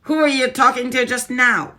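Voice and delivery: high, loud, sharp witch snap; Grand High Witch snapping in suspicion. Acted. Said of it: "snapped the Grand High Witch".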